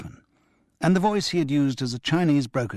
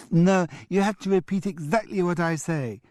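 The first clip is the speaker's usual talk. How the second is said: put-on high, cheeky boy parroting the grown-up; a mischievous twin copying grandad word for word; cheeky child mimic; 7 semitones higher than usual. A narrator's reading. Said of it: "repeated Julian, who was catching on".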